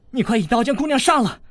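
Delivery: high, fast, furious cry; angry. A narrator's reading. Wounded girl furiously dares the boy to kill her. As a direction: spit it at him, proud and furious. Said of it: "那女子怒道".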